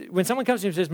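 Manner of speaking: ordinary delivery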